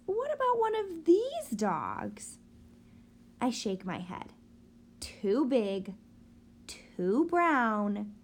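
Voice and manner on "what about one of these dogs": mom's voice. Acted. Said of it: "my mom says".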